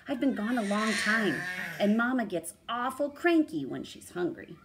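animated picture-book narrator; story narration. A narrator's reading. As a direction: read it aloud like a bedtime tale for a child. Reads story to child.

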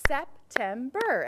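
SEPTEMBER slowly split into three claps; September broken up rhythmically; syllable by syllable. Shown as a demonstration. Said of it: "clap the rhythm of the word".

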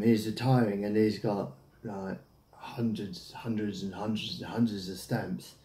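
usual speaking voice

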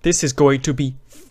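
ordinary talk, nothing marked

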